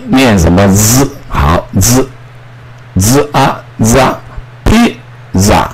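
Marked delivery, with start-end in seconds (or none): 1.8-5.7 slow sounding out. Shown as a demonstration. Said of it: "teacher sounds out Z".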